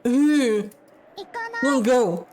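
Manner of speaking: put-on high, sulky little-girl refusal; stubborn child. Daughter refusing to leave, high stubborn child voice.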